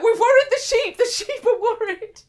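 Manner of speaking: high, loud comic alarm; mock panic. Retelling the fright over the worried sheep, mock-panicked. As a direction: exclaim in exaggerated worry, pitched right up.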